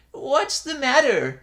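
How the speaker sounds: put-on high, slow, drowsy old priest; drowsy old man. A narrator's reading. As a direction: grumble half-awake. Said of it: "drowsily rubbing his eyes".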